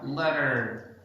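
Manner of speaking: "letter" like "ledderrr"; stressed final R. Shown as a demonstration. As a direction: draw out the R ending "letter". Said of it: "they stress in the E R".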